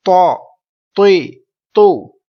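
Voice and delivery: heavy emphatic Arabic t in تو، تی، تو, loud; full, thick letter. Shown as a demonstration. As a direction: make تو، تی، تو thick and full-mouthed, each falling. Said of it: "اب ہم آواز کو پر کریں گے".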